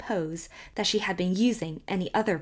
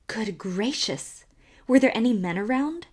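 entreating; 5 semitones higher, 5 dB louder than usual. A narrator's reading. Scandalized gossip asking about onlookers, entreating urgently. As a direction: plead to know, pitch and volume climbing. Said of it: "entreated Missus Anne Cyrilla".

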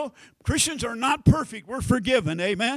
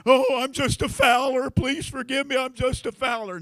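higher, loud-starting hammed-up plea for forgiveness; mock pleading player; 4 semitones higher, 4 dB louder than usual. Preacher imitating a basketball player at the camera, mock pleading. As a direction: beg the camera in a put-on wail. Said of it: "over the camera and say".

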